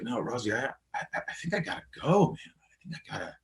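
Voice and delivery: soft and fading, almost gone by the end; faint shaky friend. Retold or imitated. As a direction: let the words drain away as he panics. Friend panicking, wants to leave.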